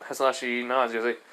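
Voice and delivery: ordinary delivery, nothing marked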